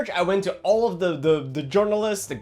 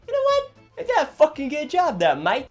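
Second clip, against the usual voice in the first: put-on sudden jokey Australian accent, pitched way up; 10 semitones higher, 4 dB louder than usual. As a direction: praise it like an over-the-top Aussie mate. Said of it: "Australian there for a second".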